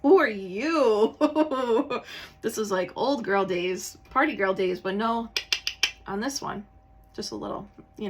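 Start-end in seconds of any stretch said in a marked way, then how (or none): 0.0-1.2 slow, loud mock cosmic voice; hammed-up slow cosmic who are you; mock planets asking. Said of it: "Neptune and Jupiter are like". 1.2-2.4 loud bright laugh; laughing at her own joke; real laugh. Real, not acted. 2.4-4.8 laughing. Real, not acted. Laughing while joking.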